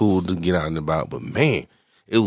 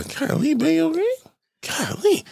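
falsetto; slower than usual. Retold or imitated. Mimicking voice in a retold story.

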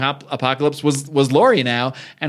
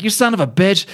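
higher-pitched mock fury; retold indignant curse; acted angry insult; 7 semitones higher than usual. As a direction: hurl it like an outraged wife. Wife's insult at attacker, retold.

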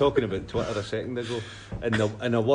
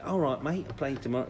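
light, higher-pitched Glaswegian Cockney impression; a hint of Glaswegian Cockney, imitating a friendly mate; lightly done Glaswegian Cockney greeting; 7 semitones higher than usual. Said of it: "sorry about the accent".

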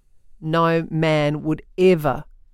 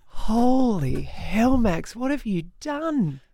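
mock horror; 3 semitones higher, much slower than usual. Real, not acted.

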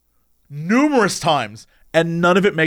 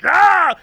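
high, loud jump-scare yell, playful; a costumed monster leaping out; haunted-house actor jumping at visitors, mock yell; mock monster roar; 10 semitones higher, 4 dB louder than usual. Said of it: "as they're like".

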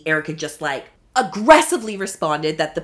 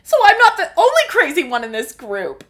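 high, louder, laughing put-on voice; imitating Rinna; 12 semitones higher, 8 dB louder than usual. Mocking Rinna's quirky remark at the spa, laughing. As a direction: ham up a giddy quirky laugh. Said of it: "Rinna goes".

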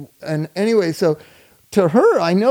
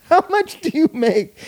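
mock innocent question, pitched higher; naive little brother; 9 semitones higher than usual. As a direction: ask it like a curious kid brother. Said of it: "And he goes".

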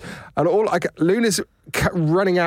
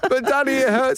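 high, hammed-up little kid whine; child complaining to daddy; whiny child voice; 10 semitones higher than usual. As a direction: play the hurt little boy, pitched up.

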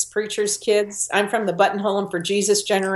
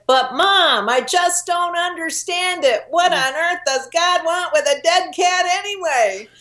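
put-on higher exasperated child voice; indignant little girl; 9 semitones higher than usual. Little girl protesting to her mother about the cat, indignant child voice. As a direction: play a sassy little girl, hands on hips. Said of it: "put her hands on her hips and she said".

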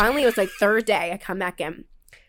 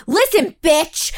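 angry; 6 semitones higher, 5 dB louder, slower than usual. Retold or imitated. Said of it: "I turned around to her and I was like".

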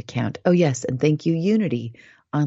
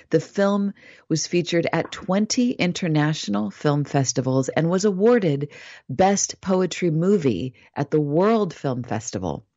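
usual speaking voice

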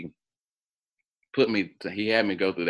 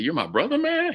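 retold, rising into a high incredulous squeak; stunned question. Recounting confronting his new brother, stunned high-pitched question. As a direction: ask it like you can't believe it. Confirms brother on DNA site.

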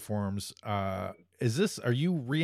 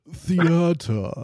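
haughty, posh-stretched «Theater» as «thee-ah-tuh»; snooty voice; 4 semitones higher, 5 dB louder than usual. Pretend. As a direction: say «Theater» like an insufferable snob. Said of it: "in the most snooty possible voice".

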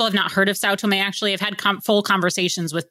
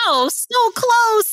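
high, drawn-out exclamation; excited near-miss reaction; 4 dB louder, much slower than usual. Real, not acted. Listener hearing host got as near as Cabo Verde, excited. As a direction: stretch it out high in playful agony.

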